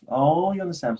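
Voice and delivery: a light imitation of the startled agent; imitating surprised agent. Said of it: "he was just like".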